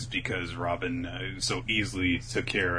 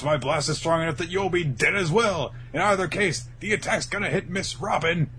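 high, theatrical villain ultimatum; gloating villain; 8 semitones higher, 3 dB louder than usual. Retold or imitated. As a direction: taunt them like a cartoon villain. Acting villain's threatening ultimatum.

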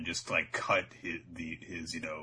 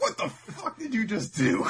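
high, loud incredulous outburst with laughter; hammed-up bafflement; mock disbelief; 13 semitones higher, 5 dB louder than usual. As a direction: demand an answer, half laughing. Zoro baffled by silly laugh.

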